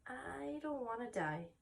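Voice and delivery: soft, slow fearful plea; frightened. Acted. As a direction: whisper your fear of dying. Frightened pig confides.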